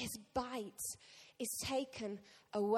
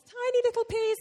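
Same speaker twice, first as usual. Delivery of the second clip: tiny little peas; 10 semitones higher, 7 dB louder than usual. Pretend. Mocking the tiny peas' bravado.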